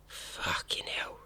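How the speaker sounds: whispered, slow swear of disbelief; hushed shock. Real, not acted. Podcaster reacting to the abduction kit, appalled whisper.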